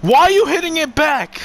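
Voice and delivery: high, loud outraged shout at a teammate; frustrated yell. Real, not acted. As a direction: yell the question in disbelief. Yelling at a teammate mid-match.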